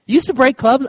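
high, loud incredulous question; stunned at the news; astonished. Retold or imitated. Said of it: "I was like".

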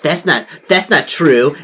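put-on mean girl losing face; flustered denial. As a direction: deny it desperately. Mean girl denies again.

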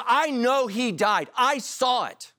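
high, slow, pained insistence; insistent Thomas. Retold or imitated. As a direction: plead what you witnessed. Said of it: "He says".

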